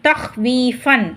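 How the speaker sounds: தஹ்வீஃபன் slowly, with வீ drawn out; lengthened vee. Shown as a demonstration. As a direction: stretch "veee" in தஹ்வீஃபன். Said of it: "வீயை வந்து நீட்டுறோம்".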